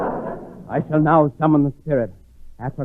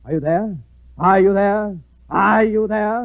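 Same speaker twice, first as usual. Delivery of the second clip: calling out louder; 7 semitones higher, 8 dB louder, slower than usual. Acted. Calling spirits louder each time.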